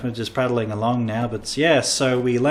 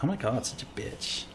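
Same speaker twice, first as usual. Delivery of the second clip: catty aside; 11 dB quieter than usual. Retold or imitated. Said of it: "then they're like".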